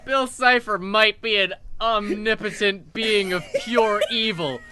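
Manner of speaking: ordinary talk, nothing marked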